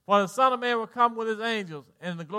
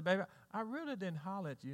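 hushed, placating husband, acted out; soft retold apology; 14 dB quieter, faster than usual. Husband apologizing to his wife, retold softly. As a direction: play back a soft, quick denial.

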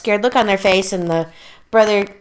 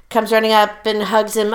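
ordinary talk, nothing marked

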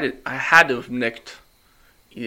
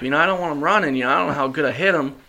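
normal talking voice

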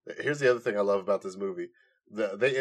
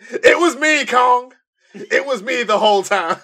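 wrestling heel reveal; 13 semitones higher, 10 dB louder than usual. Pretend. Hammed villain reveal.

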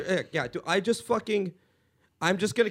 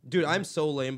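usual speaking voice

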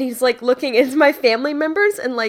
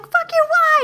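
exasperated outburst; 3 dB louder than usual. Retold or imitated. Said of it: "I'm like".

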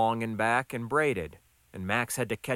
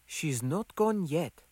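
higher, slow woman's voice; gentle admonishment; 5 semitones higher, slower than usual. A narrator's reading. Gonda admonishes Max at his side, higher slow voice. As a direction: correct him slowly, in character. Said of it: "admonished Gonda at his side".

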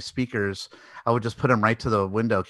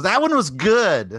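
indignant actress; 11 semitones higher, 7 dB louder, slower than usual. Retold or imitated. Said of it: "She goes".

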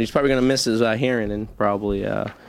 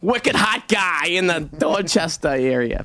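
slow, hammed-up Boston accent, higher; 6 semitones higher, slower than usual. Joking host doing a bad Boston accent for the weather. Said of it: "put on a terrible Boston accent".

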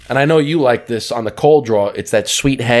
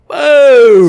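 loud, drawn-out boom, pitched up; big hyped boom; 12 semitones higher, 9 dB louder than usual. Pretend. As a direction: let it explode like a blast. Hyping the cigar with sound effect.

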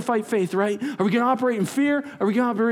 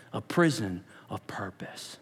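unvoiced whispered title; 11 semitones lower, 8 dB quieter than usual. Real, not acted. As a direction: hush it like a secret.

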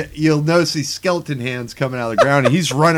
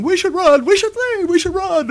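much higher, whiny mock peasants; whining villagers. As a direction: whine like cowardly villagers. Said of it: "everybody's whining to the king".

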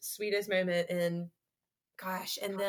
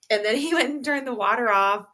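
ordinary talk, nothing marked

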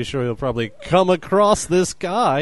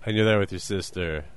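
ordinary delivery

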